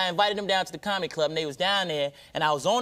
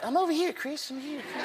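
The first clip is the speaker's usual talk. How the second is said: high, soft hushed imitation; 8 semitones higher, 3 dB quieter than usual. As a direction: say it up high and quiet, gently. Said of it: "[name] would be covered up like".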